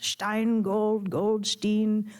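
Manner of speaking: put-on Yiddish accent, poking fun; mock the surname in a Yiddish accent. A narrator's reading. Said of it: "She said it in a mocking Yiddish accent".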